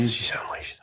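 hushed, whispery interjection; whispered aside. Real, not acted. Listener to storyteller about the Irish coworker, whispered aside. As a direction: slip it in under the other speaker's story.

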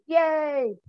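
high, bright little cheer; happy cheer. Real, not acted. Mark cheering at wanting to live freely and happy.

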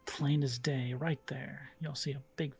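hushed unvoiced whisper. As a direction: reveal it in a secret whisper. GM whispers reveal.

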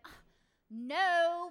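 loud groaned "no" of protest; frustrated kid. Retold or imitated. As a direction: imitate a kid's exasperated, loud refusal. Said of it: "and they're like".

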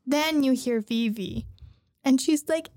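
ordinary talk

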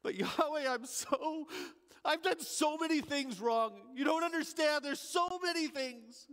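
high, urgent protest of guilt; pleading. Acted. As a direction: plead with rising desperation. Thief pleading with God.

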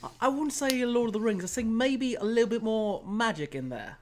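put-on lightly done British accent, playful; a hint of a British chap hinting at the movie. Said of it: "I'll break the accent now".

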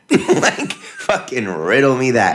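laughing disbelief, drawn out. Real, not acted. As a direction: laugh through the outrage. Mocking indignation.

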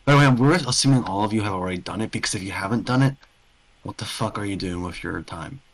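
ordinary delivery

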